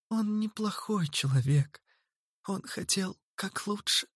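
put-on high, soft girl pleading; pitiful plea. A narrator's reading. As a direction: plead softly, almost whispered. Zerlina pleads for brother.

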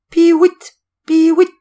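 high, loud whistled bird call; shrill bird whistle. Acted. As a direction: imitate a bird decoy piping. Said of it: "un sifflement aigu". (sifflement aigu d'appeau)

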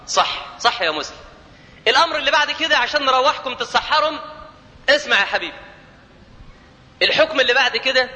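normal talking voice

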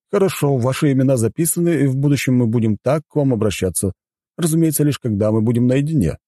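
high-pitched formal butler explaining house rules; courteous butler. Acted. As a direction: present the hotel as its dutiful butler. Voices the butler. (учтивый дворецкий)

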